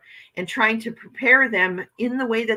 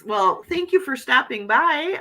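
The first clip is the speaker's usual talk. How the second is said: ordinary delivery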